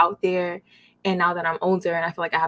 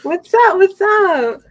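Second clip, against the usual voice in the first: much higher, louder playful greeting; high-pitched voice; 13 semitones higher, 11 dB louder, much slower than usual. Pretend. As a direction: squeal it for the audience. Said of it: "she was not with this high-pitched voice".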